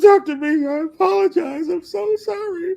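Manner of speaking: high, sobbing, wailing apology; mock crying apology. The heckler returns to Doctor B, hammed-up sobbing. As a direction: wail it out like a broken man in tears. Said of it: "comes back in the store crying".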